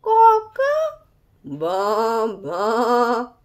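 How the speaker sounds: crow, then bleat like a sheep; crow turning into baas. Acted. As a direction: rooster baaing by mistake. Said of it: "So he tried again".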